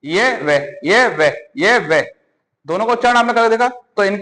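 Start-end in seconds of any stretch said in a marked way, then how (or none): 0.0-2.1 ये and वह said slowly with the tongue touching nothing; sounding out semivowels. Shown as a demonstration. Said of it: "लेकिन ये और वह".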